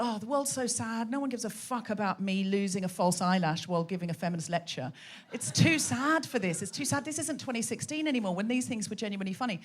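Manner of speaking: soft, mildly gloomy inner monologue; understated mock dejection; despondent. Acting out own despondent thought.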